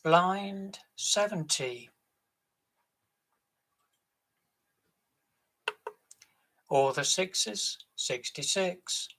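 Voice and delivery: loud, drawn-out bingo calls; bingo caller. Acted. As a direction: call the numbers across the hall. Bingo caller calling numbers.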